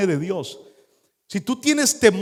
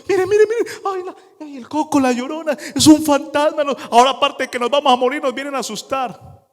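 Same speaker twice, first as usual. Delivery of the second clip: high, frantic scared babble; terrified babbling; 9 semitones higher than usual. Acted. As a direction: panic at a ghost on the water. Terrified disciples see a ghost. (balbuceo agudo y aterrado)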